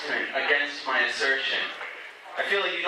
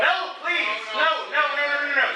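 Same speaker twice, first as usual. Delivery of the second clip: pleading no; 9 semitones higher than usual. Real, not acted. Stopping heckler coming on stage.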